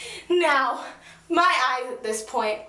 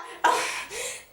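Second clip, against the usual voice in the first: breathy laugh; 10 semitones higher, 3 dB quieter than usual. Real, not acted. Embarrassed fan laughs.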